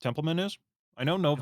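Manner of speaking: talking through a mouthful of pizza; mouth full. Real, not acted. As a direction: speak with your mouth full. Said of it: "I'm talking with my mouth full".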